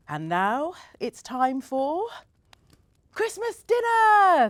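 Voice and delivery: high, loud and drawn out slow; joyful shout. A narrator's reading. Mum calling the family to Christmas dinner, shouting high and slow. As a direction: call everyone to the table at full voice. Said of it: "they all shouted together".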